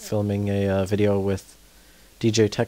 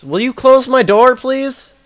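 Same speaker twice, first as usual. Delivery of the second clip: loud, high-pitched pleading shout; real fed-up shouting; exasperated yelling; 13 semitones higher, 12 dB louder than usual. Real, not acted. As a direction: holler across the house in annoyance. Yelling at family to shut door.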